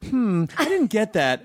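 slow, fake-friendly robot voice; robot voice playing human. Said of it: "the automated robot voice that tries to sound human".

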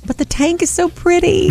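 gushing. Real, not acted. Gushing over the fish tank.

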